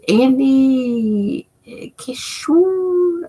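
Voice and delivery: "Kishore" drawn way out and pitched high; stress on wrong syllable. Real, not acted. Reading a viewer's name, wrong-syllable stress on "Kishore". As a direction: stretch "Kishore" slowly, leaning on the wrong syllable. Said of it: "putting emphasis on the wrong syllable".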